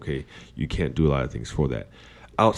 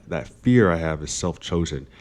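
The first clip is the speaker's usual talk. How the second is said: ordinary talk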